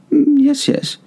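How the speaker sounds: very high, coy sing-song yes; sly Montresor. A narrator's reading. Montresor slyly assuring Fortunato, very high and coy.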